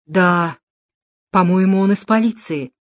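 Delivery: ordinary delivery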